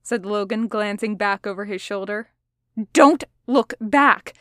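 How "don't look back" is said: loud, slow, emphatic snap; sharp, forceful order on the run; snapped warning. A narrator's reading. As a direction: snap each word out hard and raised. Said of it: "Roman snapped".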